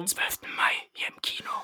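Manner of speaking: whispered trailer tagline; hushed, dramatic whisper; movie trailer voice. Acted. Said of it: "Deine Trailer-Ansager-Stimme".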